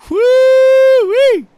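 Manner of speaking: loud, high, sustained woo-wee; excited whoop. Real, not acted. Commentator thrilled by a move to first, loud high whoop. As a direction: let out a piercing race-fan whoop. Racer jumps to first off start.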